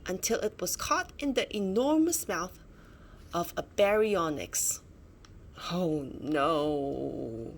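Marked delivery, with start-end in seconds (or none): 5.7-7.5 low, dismayed oh no; dismayed aside. Acted. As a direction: sink your voice in dismay. Egg caught in a mouth.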